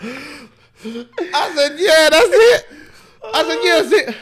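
high, squeaky, tearful laughing; real laughing-crying fit; helpless laughter. Real, not acted. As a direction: repeat it while unable to stop laughing. Cracking up at declined card.

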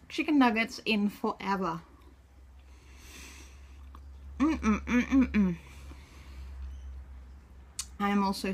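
talking while chewing food; muffled through food; mouth full chewing. Real, not acted. Seen 0: Bitten nugget held, then whole piece in mouth, cheeks chewing.

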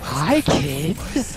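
put-on creepy stranger greeting kids; lightly sinister, luring voice. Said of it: "do you want me to try".

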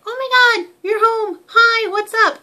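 very high, loud squawky greeting; high-pitched bird screech. Retold or imitated. Excited bird screaming at owner coming home, very high and loud. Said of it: "the really high-pitched sound that she gives".

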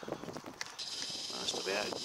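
low breathy whisper of the same line. Pretend.

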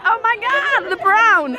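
high-pitched mock gasp of amazement; mimicked crowd reaction. Friends reacting to the brown, high mock exclaiming. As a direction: imitate everyone exclaiming, voice pitched right up. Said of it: "everyone's like".

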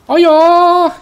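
high, loud delighted yells; excited shouting. Real, not acted. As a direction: cheer it out at the top of your voice. Ecstatic cries at game event.

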